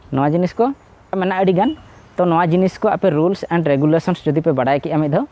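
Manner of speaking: ordinary talk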